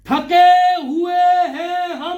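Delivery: loud, drawn-out words for a class to repeat; slow, projected articulation drill; broken into words. Shown as a demonstration. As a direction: lead a chant, stretching each word. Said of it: "शब्द को अक्षरों में तोड़ तोड़ के पढ़ना".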